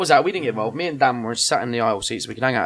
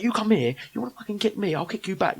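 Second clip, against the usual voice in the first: high-pitched heated threat, retold; mock aggro challenge; losing his rag; 7 semitones higher than usual. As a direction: act out squaring up for a fight. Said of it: "I just lost my rag".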